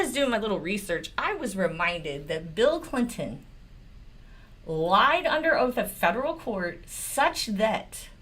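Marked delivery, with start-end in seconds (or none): none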